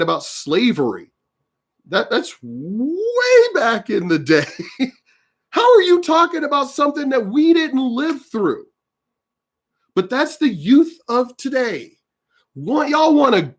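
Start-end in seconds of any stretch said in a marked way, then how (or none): 1.9-4.9 slow, laughing through the words. Real, not acted. Laughs while ranting. 5.5-13.1 higher-pitched incredulous rant. Real, not acted. Ranting at young people.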